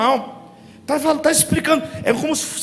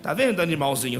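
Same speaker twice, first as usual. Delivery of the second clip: muttered scolding; 8 semitones lower, 6 dB quieter, faster than usual. Retold or imitated. Father scolding crying child, retold.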